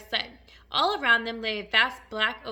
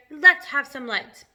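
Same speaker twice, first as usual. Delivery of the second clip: loud, slow, drawn-out call; crowd cry; 3 semitones higher, 11 dB louder, slower than usual. A narrator's reading. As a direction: cry out for light all together. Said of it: "they cried".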